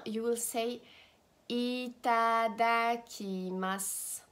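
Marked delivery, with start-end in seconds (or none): 1.5-4.2 "itadakimasu" stretched out syllable by syllable; slow teaching model. Shown as a demonstration. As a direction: say "itadakimasu" slowly for learners.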